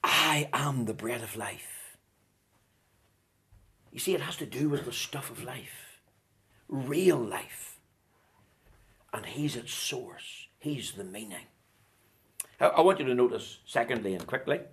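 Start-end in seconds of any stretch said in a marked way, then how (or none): 0.0-11.5 low, soft voice after the climax; hushed solemn preaching. Real, not acted. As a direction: let it sink in quietly.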